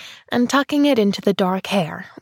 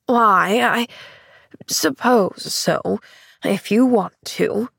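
grudging; slower than usual. Acted. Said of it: "permitted Missus Snow grudgingly".

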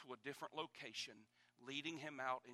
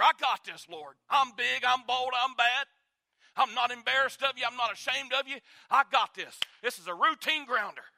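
loud, high swagger; cocky boast; 10 semitones higher, 18 dB louder than usual. Retold or imitated. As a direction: brag like a sure champion. Peter boasting to the Lord.

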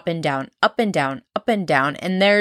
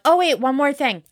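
higher-pitched imitation of a lingering caller; chatty phone caller; 7 semitones higher than usual. Caller who won't hang up, eager interruption. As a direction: pipe up as the caller who remembers something. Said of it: "then they're like".

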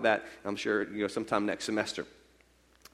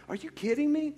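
incredulous; 8 semitones higher, 6 dB quieter than usual. Retold or imitated. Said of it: "I'd be like".

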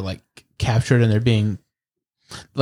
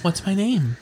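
soft feminine male voice; 9 semitones higher, 3 dB quieter than usual. Acted. Bird spirit teases the player.

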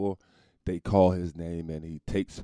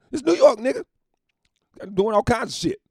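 exasperated rant; 14 semitones higher, 7 dB louder than usual. Retold or imitated. Comedian lecturing the cokehead.

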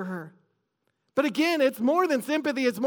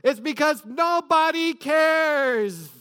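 slow, drawn-out raised cry; echoing through eternity; 4 semitones higher, slower than usual. Retold or imitated. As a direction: stretch each word higher, as if echoing forever. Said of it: "it echoed like all throughout eternity".

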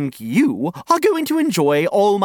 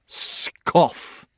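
scoff; 3 dB quieter than usual. A narrator's reading. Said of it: "the lion was unimpressed".

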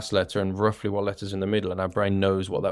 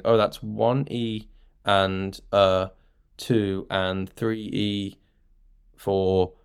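slow counting; much slower than usual. Shown as a demonstration. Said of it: "rather than counting".

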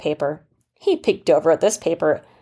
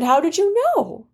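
put-on surprised boy; 11 semitones higher than usual. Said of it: "And the boy said".

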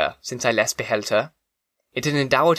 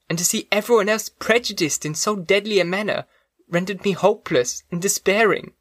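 put-on higher, distressed young woman voice; grateful and near despair, voiced higher; tearful young woman; 6 semitones higher than usual. Elizabeth, grateful then despairing.

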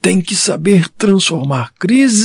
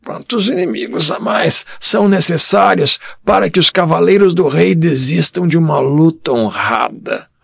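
put-on higher, scornful old father; scornful tut; 9 semitones higher than usual. A narrator's reading. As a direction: ask it with disdain. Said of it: "com um muxoxo". (com desdém de velho)

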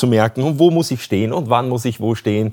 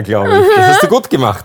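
ordinary delivery

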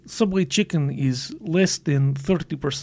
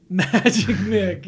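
slow, louder, laughing through it; 4 semitones higher, 6 dB louder, much slower than usual. Real, not acted. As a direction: savour the name with a laugh. Laughing repeat.

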